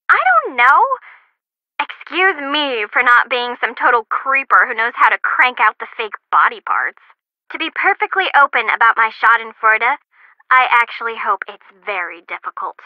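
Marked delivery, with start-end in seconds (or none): none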